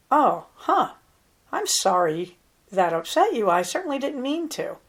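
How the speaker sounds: sincere, unhurried apology with pauses; softened apology. Shown as a demonstration. Coach showing how to soften toward an upset person, sincere and measured. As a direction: take it in with "oh, huh", then apologize gently. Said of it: "That won't work as well as".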